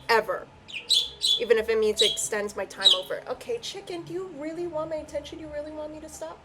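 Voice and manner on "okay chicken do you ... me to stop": cooing to a pet. Real, not acted. Reader asks chicken if they want attention, cooing softly. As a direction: coax sweetly in a hushed, high-pitched voice.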